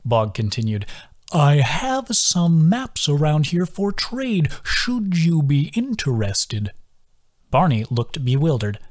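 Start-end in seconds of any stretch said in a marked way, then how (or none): 1.3-6.7 put-on slow, high, lilting old creature; slow sing-song voice of a gentle old turtle. A narrator's reading. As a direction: draw each word out in a musical sway. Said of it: "His sing-song voice".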